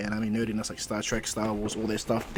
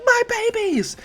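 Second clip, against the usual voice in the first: very high, loud wail of dismay; anguished shriek; 7 dB louder, slower than usual. Acted. Mei sees Deku break her inventions apart, shrieking in high, loud despair. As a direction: shriek as if her children were smashed.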